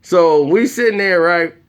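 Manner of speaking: usual speaking voice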